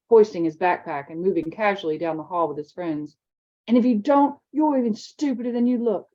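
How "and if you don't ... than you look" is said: a hint of a mocking older boy; sneering bully. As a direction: toss the insult over the shoulder. Bully's parting insult.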